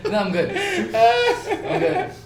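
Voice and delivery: laughing. Real, not acted. Eyes burning, laughing it off.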